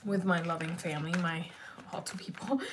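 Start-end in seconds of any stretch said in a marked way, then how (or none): 1.9-2.6 unvoiced whisper; whispered shout-out; whispered aside. Real, not acted. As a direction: drop to a quick whisper for the name. Whispered aside in livestream chat.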